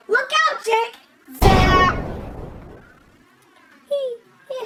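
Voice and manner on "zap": loud zap. Acted. Robot zaps the snow.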